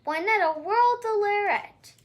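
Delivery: usual speaking voice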